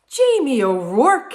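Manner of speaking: put-on calling out slow and loud in an Irish accent; Irish wife calling her lazy husband; wife calling husband by name, Irish accent. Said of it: "his wife Eileen said".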